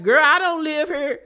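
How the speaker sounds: a high, loud mocking comeback; mock sassy retort. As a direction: fire it back high and loud. Kanan snapping at his mother.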